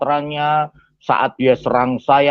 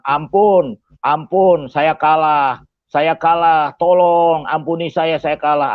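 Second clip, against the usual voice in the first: raised, insistent begging for mercy; imitated pleading; 2 semitones higher, slower than usual. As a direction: act out a beaten man begging. Defeated attacker begs for mercy. (meniru orang memohon ampun)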